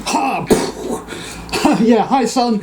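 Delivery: high, laughing mock greeting; imitated ghost laughing his hello; jaunty laughing. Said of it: "laughing, you know, all jaunty like".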